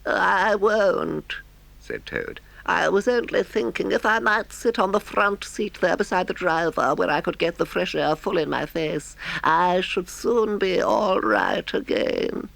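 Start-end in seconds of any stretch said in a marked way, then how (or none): 0.0-1.4 high, slow feigned feeble washerwoman. Acted. Toad keeps up feeble act. 2.7-12.5 feeble voice. Acted. Toad keeps up feeble act.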